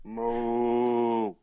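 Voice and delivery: low drawn-out moo; cow moo. Acted. Animal noise guessing game.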